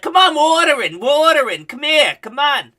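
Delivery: high, loud yell across the room; mock yelling mom. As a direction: call the son over in a shrill mock shout. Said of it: "yelling across the pizzeria".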